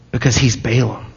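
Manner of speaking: half-whispered sarcasm about how great he thinks he is; mock boasting. Mocking Balaam's self-image.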